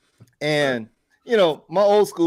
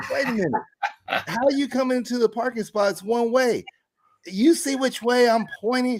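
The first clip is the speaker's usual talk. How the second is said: reasoning with her; 9 semitones higher than usual. Retold or imitated. He explains the one-way street to the angry lady, pitched-up exasperation. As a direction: lay out the obvious in mock disbelief. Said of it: "And I'm like".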